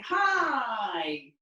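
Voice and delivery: loud, bright calls of hello; friendly loud hellos; greeting passers-by. Shown as a demonstration. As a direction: call hello across the street. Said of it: "So we're gonna say".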